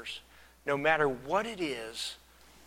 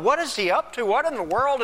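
baffled onlookers; 8 semitones higher, 5 dB louder than usual. Acted. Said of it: "they're all kind of".

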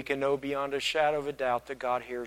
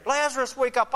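high-pitched raised call to a sleeper; mock yell; 9 semitones higher, 3 dB louder than usual. Yelling into the tomb at Lazarus, raised voice. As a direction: call out to wake someone in a tomb. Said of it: "who was he yelling at there?".

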